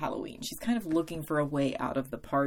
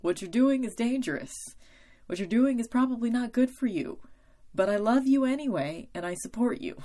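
higher, louder, earnest caring warning; Oz's voice; 7 semitones higher, 5 dB louder than usual. Retold or imitated. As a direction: voice Oz disapproving but loving. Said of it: "Oz being like".

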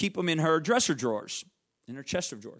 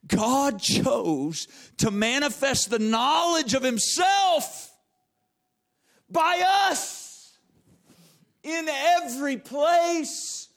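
slow, higher and louder shout; preaching shout; 7 semitones higher, 4 dB louder, much slower than usual. Acted. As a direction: build it up and preach it full force. Preacher builds to climax.

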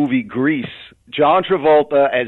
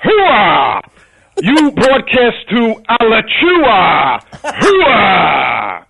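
loud gung-ho Pacino shouting hooah; 6 semitones higher, 4 dB louder, much slower than usual. Pretend. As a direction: bark it like a fired-up colonel. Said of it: "I thought as Al Pacino, he would say".

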